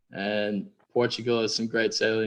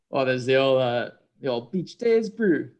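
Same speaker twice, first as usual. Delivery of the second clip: slow, lightly put-on Kiwi accent; a hint of a New Zealand surfer voice. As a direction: drawl it out like a laid-back Kiwi. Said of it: "give us a phrase in Kiwi".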